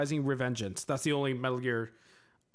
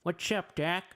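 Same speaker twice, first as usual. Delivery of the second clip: higher retry of the cartoon rabbit's greeting; Bugs Bunny impression; 5 semitones higher than usual. Streamer retrying the rabbit's catchphrase, a bit higher. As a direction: pitch the catchphrase up for a second go. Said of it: "That's my Bugs Bunny impression".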